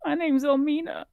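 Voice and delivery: put-on high, small child voice; little elf girl telling the boy her name; timid little girl. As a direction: answer shyly as a little girl. Girl introduces herself.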